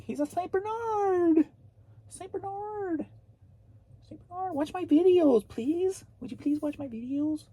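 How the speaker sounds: very high, squeaky put-on voice; squeaky pleading voice. Begging viewers to watch the videos, squeaky and playful.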